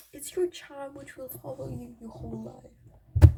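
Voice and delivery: slow, loud mock-solemn quote; lightly voiced wise friend; quoting friends. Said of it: "and they say, like".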